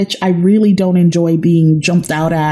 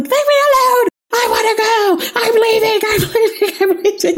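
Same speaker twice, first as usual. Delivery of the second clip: high, panicked cry, laughing through it; half-laughing retelling of a panicked getaway; mock hysterical; 14 semitones higher than usual. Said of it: "hysterical at this point".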